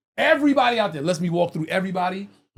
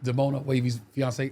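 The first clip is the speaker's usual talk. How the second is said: ordinary talk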